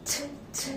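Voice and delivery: whispered t with the tongue on the teeth; soft t. Shown as a demonstration. Teacher modelling the soft t. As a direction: tap t softly. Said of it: "very softly".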